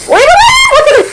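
sudden high cry of dismay; surprised exclaim. Real, not acted. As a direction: yelp as it all spills. Puffed rice spills everywhere.

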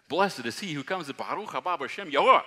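loud, high jubilant crowd cry; crowd shouting. Retold or imitated. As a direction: shout it out like the palm-waving crowd. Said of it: "They were shouting".

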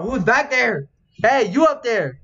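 high, stretched call into the distance. Pretend. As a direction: challenge whoever is hiding up there. Calling to someone hidden.

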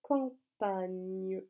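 campagne drawn out in syllables; slow dictation. Shown as a demonstration. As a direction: stretch campagne slowly for writing. Dictating the word once more. (campagne dicté lentement, syllabe par syllabe)

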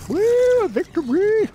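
high, loud victory whoop; excited cheer. Real, not acted. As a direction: cheer the win at the top of your voice. Celebrates beating the game.

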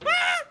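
loud, very high shriek; startled scream. Real, not acted. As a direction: shriek in sudden alarm.

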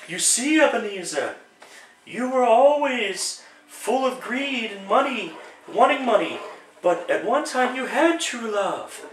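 hushed falsetto ghost. Acted. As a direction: gently remind him as the ghost. Ghost narrates softly.

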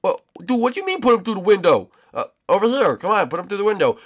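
usual speaking voice

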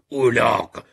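high, very loud angry insult; angry outburst. A narrator's reading. Fuming man curses a publisher in the elevator, furious.

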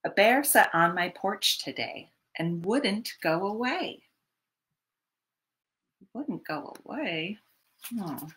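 ordinary delivery

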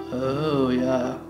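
ordinary talk

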